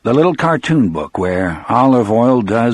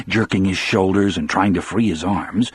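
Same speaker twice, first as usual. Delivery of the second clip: usual speaking voice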